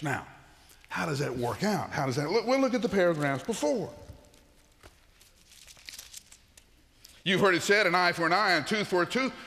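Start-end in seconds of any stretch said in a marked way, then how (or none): 2.5-9.3 high, loud scripture read; preaching at full force. Acted. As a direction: proclaim the old law boldly.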